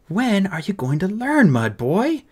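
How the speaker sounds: high, slow scolding, dropping at the end; snapped. Acted. Fairy blaming the boy for his injured bodyguard, snapped. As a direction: snap at the boy in exasperation. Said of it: "snapped the fairy".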